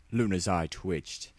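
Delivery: usual speaking voice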